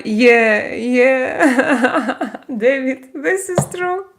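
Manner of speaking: real laughter breaking into the words; laughing. Real, not acted. Streamer telling David it's true, laughing. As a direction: crack up while agreeing. Laughing at her own joke.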